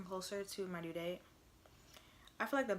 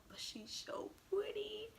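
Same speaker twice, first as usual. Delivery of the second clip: much higher, whispered; 13 semitones higher, slower than usual. Real, not acted. Mom whispering over her pretty baby. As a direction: whisper it in awe.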